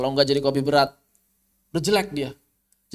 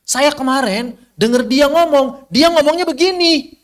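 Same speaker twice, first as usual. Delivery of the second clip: high, loud cut-off chatter; mock chatty gossip; 11 semitones higher, 8 dB louder than usual. Teacher acting a speaker who stops mid-sentence, mock chatty.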